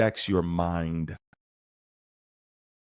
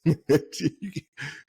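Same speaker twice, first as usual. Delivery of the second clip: quick amused laugh at the bit; real chuckle; short laugh; 8 semitones higher than usual. Real, not acted. Laughs at own acted scene.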